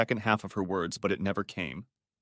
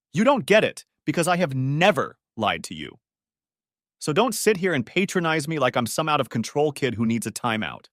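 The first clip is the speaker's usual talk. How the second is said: loud, higher furious shout; angry shouting; 4 semitones higher, 4 dB louder than usual. Acted. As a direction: yell it, finger jabbing.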